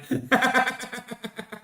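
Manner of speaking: high, tailing-off chuckle; staccato run of laughter; long laugh. Real, not acted. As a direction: laugh on and let it die away. Laughs at his own remark.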